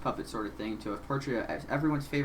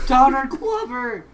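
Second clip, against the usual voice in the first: excited shout; 11 semitones higher, 11 dB louder, slower than usual. Real, not acted. Sees favourite actor on screen.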